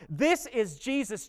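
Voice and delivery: ordinary talk, nothing marked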